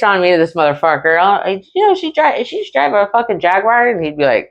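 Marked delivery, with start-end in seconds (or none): 1.2-3.9 high-pitched mimicry of snitches; mock tattling. Cops snitching on her to the P O, high mocking imitation.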